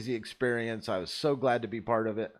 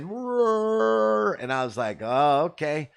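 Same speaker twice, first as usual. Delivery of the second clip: drawn-out, high, louder wow; thrilled; 6 semitones higher, 6 dB louder, much slower than usual. Retold or imitated. Driver after sliding the van sideways, thrilled and loud. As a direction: savor the rush of pulling it off.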